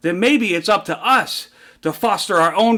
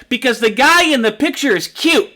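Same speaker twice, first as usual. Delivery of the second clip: put-on little girl; 5 semitones higher, 4 dB louder than usual. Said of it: "this little girl stands up".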